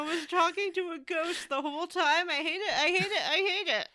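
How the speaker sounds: half-laughing frustration; exasperated. Real, not acted. Ranting to her cohost about the ghost twist: high exasperated wail.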